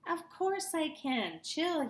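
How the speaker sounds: lightly voiced confident brainy kid; smug kid voice. A narrator's reading. Know-it-all kid brushing off the worried narrator.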